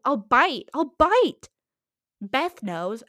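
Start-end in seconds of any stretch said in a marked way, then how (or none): 0.0-0.7 loud playful threat. Real, not acted. Threatening Little Huddy, a loud playful boast. Boasting she could fight him. 0.7-1.5 higher, louder, hammed-up threat. Real, not acted. Threatening Little Huddy again, a higher hammed-up shout.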